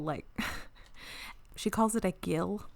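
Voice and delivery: ordinary delivery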